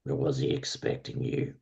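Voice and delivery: low, soft servant voice; surly servant. Acted. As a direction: question the caller quietly, a touch surly. Surly servant questioning a caller.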